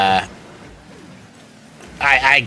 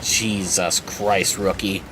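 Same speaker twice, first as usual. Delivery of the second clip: low, slow, quiet scoff; exasperated scoff; 6 semitones lower, 7 dB quieter, much slower than usual. Retold or imitated. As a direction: sigh it out in disbelief. Coworkers scoffing in disbelief.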